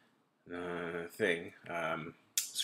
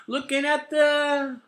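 put-on high, loud kid voice, slow; hammed-up child voice; pretending to be a seven-year-old; 9 dB louder than usual. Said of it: "I was pretending to be a seven-year-old".

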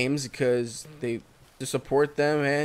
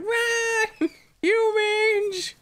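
squeaky, much higher mock creature voice; animal's panicked cry; 3 dB louder, much slower than usual. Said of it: "He's like".

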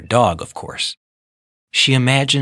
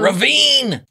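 high excited shout; 14 semitones higher, 3 dB louder than usual. A narrator's reading. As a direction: call it out with glee. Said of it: "shouted Tony".

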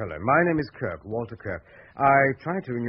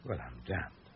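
stunned mutter; 11 semitones lower, 13 dB quieter than usual. Acted. Writer alone after the call.

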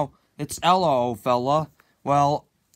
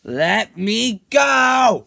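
very slow, loud, higher yell; drawn-out shout; 4 semitones higher, 7 dB louder, much slower than usual. Acted. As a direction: stretch the cry out desperately. Final struggle to escape.

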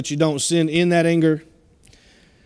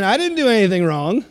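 voicing Cain; 6 semitones higher than usual. Cain voiced blaming God.

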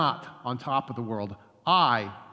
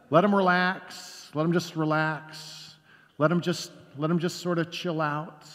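mock sarcastic; 4 semitones higher than usual. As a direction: sneer at the settled old-timers, half joking. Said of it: "what do they say about new Christians".

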